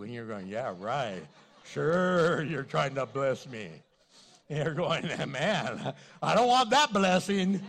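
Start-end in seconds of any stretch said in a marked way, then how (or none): 0.5-3.7 sarcastic retort. Retold or imitated. Retold sarcastic inner reply. 5.3-7.6 loud, mock-offended protest; mock indignant. Retold reply, loud.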